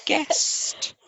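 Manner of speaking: high, silly list of titles; absurdly high and hammed-up; ridiculous intro. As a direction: offer each title in a high mock-announcer voice. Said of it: "you do it very ridiculously".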